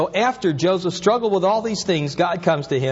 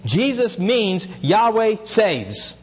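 higher and slow, spelling out "Jesus means Yahweh saves"; slow, weighty teaching; 6 semitones higher, much slower than usual. Shown as a demonstration. Pastor explains the name to his congregation, slowly. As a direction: lay each word down deliberately. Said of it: "teaching the name's meaning".

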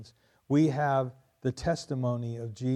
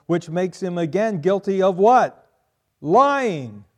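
louder, higher, building to a cry; emphatic preaching; 6 semitones higher, 10 dB louder than usual. Real, not acted. Preacher pressing the congregation on lying, at full force. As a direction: drive the answer home hard. Preacher hammering his point.

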